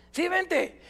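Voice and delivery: put-on high, boyish quick invitation; immature dad. Father repeats his invitation to the boy, immature eagerness. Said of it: "era tan inmaduro como él".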